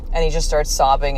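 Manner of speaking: usual speaking voice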